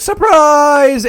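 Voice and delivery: high, loud cry of surprise; mock surprise shout. The gang jumping out at Daphne, loud high surprise. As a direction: leap out yelling it.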